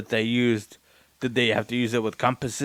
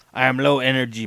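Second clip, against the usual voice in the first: raised voice, pushed out louder at first; projecting more; 3 semitones higher, 8 dB louder than usual. Real, not acted. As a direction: speak up toward the microphone. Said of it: "I'll try and project more".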